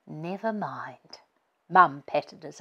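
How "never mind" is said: soft, slow, comforting whisper; gentle whisper. Acted. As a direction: whisper it to soothe him. Mum comforting injured dad.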